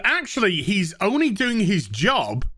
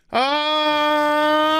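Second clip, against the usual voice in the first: a loud, higher, sustained frustrated shout; frustrated outburst; 7 semitones higher, 3 dB louder than usual. Real, not acted. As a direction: let the annoyance burst out. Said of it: "That was meant to stay inside".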